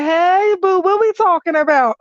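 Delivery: high, bright-toned mock sweetness; sugary greeting. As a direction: greet him sweetly to stake a claim. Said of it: "I just be like".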